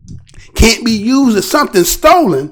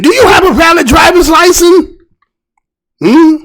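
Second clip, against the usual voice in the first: mock officer question; 6 semitones higher than usual. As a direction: ask it like the second cop, high. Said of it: "the other one is asking you".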